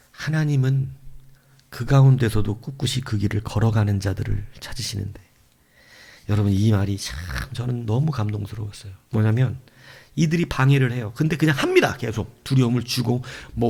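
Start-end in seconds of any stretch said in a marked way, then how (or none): none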